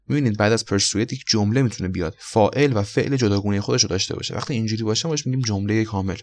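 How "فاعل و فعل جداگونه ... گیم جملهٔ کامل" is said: normal talking voice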